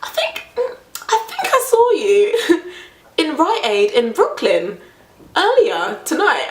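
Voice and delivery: slowly building the accusation; imitating the driver. Driver voiced in retold story.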